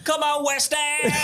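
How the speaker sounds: high Cockney football chant; Cockney accent. Pretend. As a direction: shout it like a West Ham fan. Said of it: "Give us your best Cockney accent".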